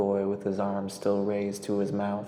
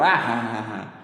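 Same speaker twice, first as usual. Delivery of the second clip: laughing aloud; 5 dB louder than usual. A narrator's reading. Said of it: "he laughed out loud".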